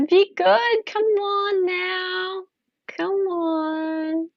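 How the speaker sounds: high, slow, sultry tease; seductive voice. Pretend. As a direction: coo it out as a slow temptress. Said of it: "Her seductive voice".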